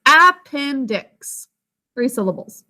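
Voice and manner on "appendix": Appendix drawn out slowly, every syllable clear, first a full; careful classroom Appendix with a full first vowel; slow spelling pronunciation. Shown as a demonstration. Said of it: "Can you sound it out with me?".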